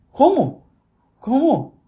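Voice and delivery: bewildered, demanding challenge; indignant disbelief. Real, not acted. Vlogger baffled by cold anchors, high indignant disbelief. Outraged at cold news reading.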